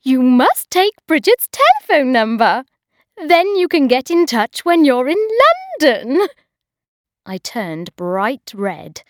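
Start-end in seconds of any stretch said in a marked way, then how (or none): 0.0-6.3 loud, much higher nagging voice; bossy hostess. A narrator's reading. Hostess nagging Bridget about marriage, high and loud. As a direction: lecture her like a bossy aunt. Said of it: "she said".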